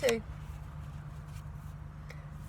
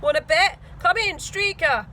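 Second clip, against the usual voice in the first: radio call; 4 semitones higher, 7 dB louder than usual. A narrator's reading.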